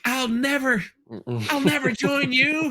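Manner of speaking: high-pitched mock-defiant little girl; Leia. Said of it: "little Leia was like".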